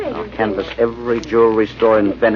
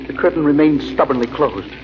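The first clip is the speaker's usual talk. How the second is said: hushed, unhurried storytelling; low radio narrator voice, sinking softer toward sleep; story narration; 4 semitones lower, 3 dB quieter than usual. A narrator's reading. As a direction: draw the listener into the dream. Narrator tells his dream.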